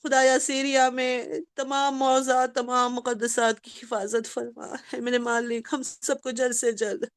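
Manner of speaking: low, soft, pleading supplication; closing dua of the majlis; prayer. Real, not acted. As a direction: pray quietly for the congregation.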